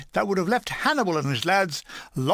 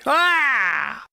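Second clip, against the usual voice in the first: mock cry; 5 semitones higher, 4 dB louder than usual. Pretends to hurl himself out.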